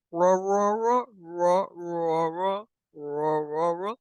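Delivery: low booming roar chant; dopey cartoon bellow. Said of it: "You hear a deep voice saying".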